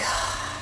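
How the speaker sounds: long, weary breath out; exasperated sigh. Retold or imitated. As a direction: sigh like you've given up on him. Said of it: "was exasperated".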